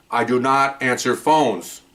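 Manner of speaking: slow, each word spaced out; emphatic, drawn-out refusal; deliberate declaration. Retold or imitated. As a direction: state it slowly for the record. Spelling it out for tape recorder.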